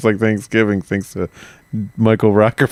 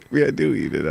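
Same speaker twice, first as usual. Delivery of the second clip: ordinary delivery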